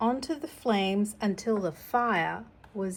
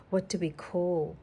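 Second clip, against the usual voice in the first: ordinary delivery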